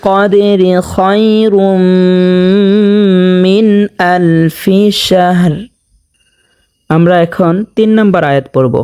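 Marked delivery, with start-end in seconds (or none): 0.1-5.5 slow chanted tajweed recitation; Quran recitation. Shown as a demonstration. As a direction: recite slowly, stretching each sound.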